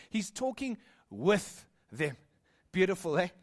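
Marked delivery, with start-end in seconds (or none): 0.1-2.2 "with" drawn out slowly and set apart; slow right down, leaning on "with"; stress on with. Real, not acted. Preacher contrasting talking at people with talking with them, emphatic "with".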